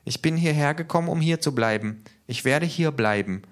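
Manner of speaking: high-pitched character voice; K, small creature voice. A narrator's reading. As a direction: speak high up as little K. (hohe Figurenstimme)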